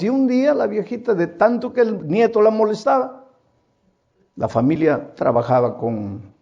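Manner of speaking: normal talking voice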